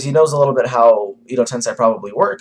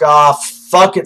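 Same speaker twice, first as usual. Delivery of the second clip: mock frustrated; 5 semitones higher, 7 dB louder, much slower than usual. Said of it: "and then we're like".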